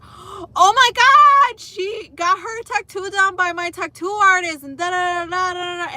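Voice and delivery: put-on high, loud squealing girl; gushing stranger. Mocking the stranger squealing over the tattoo artist, high excited. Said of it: "She was like".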